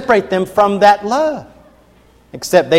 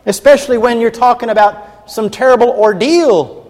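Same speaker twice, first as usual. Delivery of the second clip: ordinary talk, nothing marked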